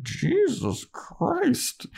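slow, high-pitched mock shock; Harmony. Girlfriend reacting to the vampire's threat, high slow mock shock. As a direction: draw it out in a squeaky, taken-aback voice.

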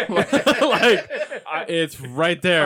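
loud laughing disbelief. Real, not acted. Teller laughs recalling the new store right there. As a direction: laugh through the words. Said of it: "laughing at the story".

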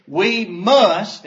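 "must" punched loud and falling; stress on must. Shown as a demonstration. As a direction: hit the word "must" hard. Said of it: "and underline that word".

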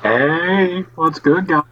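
muffled bad connection audio; underwater. Real, not acted. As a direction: sounds like talking underwater. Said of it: "Derek sounds like he's underwater".

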